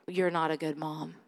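low, soft accusing voice; enemy's lie. Retold or imitated. The enemy feeding a mom a lie, quietly accusing. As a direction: say it softly and low, as an insinuation. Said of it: "the lie that the enemy".

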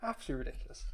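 very soft, spent grumble; muttered indignation. Acted. Host finishing a spelling rant, muttering in mock disgust. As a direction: drop to an under-the-breath mutter after the outburst. Subsides after mock outrage.

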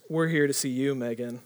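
low, soft mock-suave line; imitating a would-be charmer, voice dropped low; smooth talker. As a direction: lay on the hushed charm. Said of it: "thinks that he's smooth".

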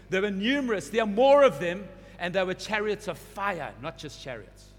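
rising sermon climax; fervent preaching. Real, not acted. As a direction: drive the vision home to the congregation. Preaching build at full force.

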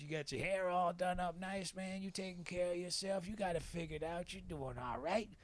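put-on voice of an older Southern stranger; Bay. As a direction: compliment an old friend in an old-timer's voice. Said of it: "uh, he goes".

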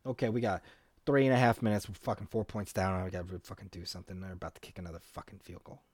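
low, very soft muttering; quiet game aside. Real, not acted. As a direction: mutter it fast under your breath.

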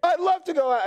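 put-on a failed stab at a British accent, half laughing at yourself; bad British accent. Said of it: "I can't do the British accent".

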